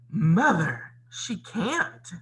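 loud whine on the first word, then high protest; gasping shock. A narrator's reading. Stepsisters appeal to their mother, shocked. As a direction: protest in high, acted outrage. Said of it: "Anastasia and Drizella gasped".

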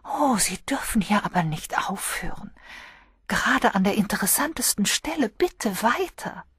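high, breathy plea to go on; begging, out of breath; breathless pleading girl. A narrator's reading. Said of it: "die von der schwer atmenden Jane Hellier".

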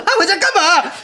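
high, loud, laughing mock rant; exasperated scolding. Boss ranting about the worker who ran home, high and laughing. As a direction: scold the runaway worker in disbelief.

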